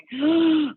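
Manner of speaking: high, startled gasp; nervous backstage gasp of doubt; sharp gasp. Retold or imitated. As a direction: suck in air in sudden fright. Scared backstage.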